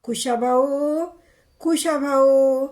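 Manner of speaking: high, drawn-out call to a neighbour. Acted. Wife summoning the neighbour from next door, calling out. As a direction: holler the name, stretching it out. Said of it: "कुशाभाऊले हाकारा घातला".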